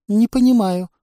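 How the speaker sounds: высоко, громко, огорчённо; огорчись, как растерянное существо; расстроенно. Acted. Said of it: "расстроилась Дологнистка". (Дологнистка расстроенно отвечает на допрос, высоко и громко)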